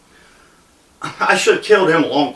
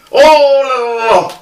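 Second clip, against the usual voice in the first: mock flustered objection; alarmed protest; 4 semitones higher, slower than usual. Retold gunman refusing the dare to shoot, mock alarmed protest.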